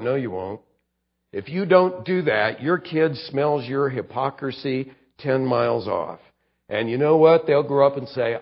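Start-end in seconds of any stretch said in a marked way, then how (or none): none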